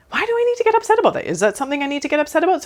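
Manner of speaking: mock your own fuss in a high, quick voice; mocking self-question. Mocking her own overreaction.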